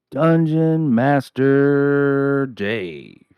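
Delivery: loud, high, very slow announcer voice; grand self-announcement. Acted. Host introduces himself to viewers as a drawn-out announcer. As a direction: boom out the title like a ring announcer.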